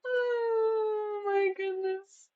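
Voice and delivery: very slow, high, drawn-out sigh of disbelief; exasperated. Real, not acted. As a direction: stretch it out in fond exasperation. Streamer exasperated at bot.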